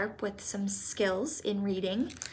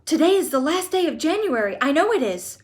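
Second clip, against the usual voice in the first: high, loud and fast in alarm; the date has crept up on her; excited cry; 7 semitones higher, 9 dB louder than usual. Acted. Mrs Bucket realising the visit is tomorrow, loud excited cry. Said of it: "cried Missus Bucket".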